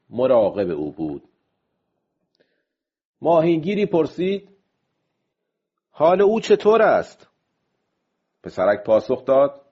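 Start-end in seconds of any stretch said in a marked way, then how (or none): 5.9-7.3 loud, high-pitched call; called-out question. Acted. Fisherman asking the boy about the old man, calling out. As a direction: raise the voice and pitch to ask.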